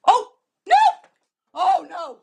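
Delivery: panicked shriek; terrified horror victim. Real, not acted. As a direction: scream in real panic. Dog heading for skunk.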